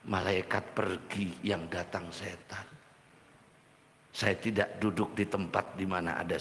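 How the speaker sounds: hushed and drawn out, ominous; solemn hush. Acted. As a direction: drop the voice for the warning. Prophet's grave words quoted.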